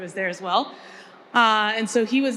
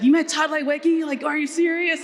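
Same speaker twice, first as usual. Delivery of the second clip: higher and louder, thrilled disbelief; excited dad; 7 semitones higher, 3 dB louder than usual. Retold or imitated. Dad reacting to hearing about Todd Leiweke, excited disbelief. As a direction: sound like a father who can't believe his luck. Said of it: "I'm so excited".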